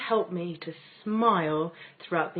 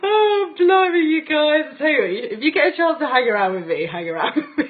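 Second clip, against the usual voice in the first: real laughter; 8 semitones higher, 10 dB louder than usual. Real, not acted. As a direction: laugh helplessly while still talking. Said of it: "I don't mean to laugh".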